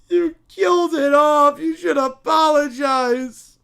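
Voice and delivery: put-on loud, slow crying kid whine; bratty child. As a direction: wail like a spoiled child. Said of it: "the Brat Pack".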